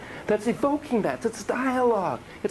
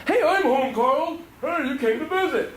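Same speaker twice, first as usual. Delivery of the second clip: higher, louder happy hello; cheerful calling out; 9 semitones higher, 3 dB louder than usual. Pretend. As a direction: call out gladly on arriving home. Doll calling into house.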